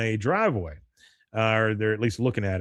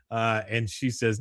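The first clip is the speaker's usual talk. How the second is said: ordinary talk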